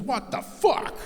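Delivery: high outraged squawk, slow on no happy ending; be scandalised, pitch flying up; shocked clerk's high outrage; indignant. Retold or imitated. Tire clerk offended by question.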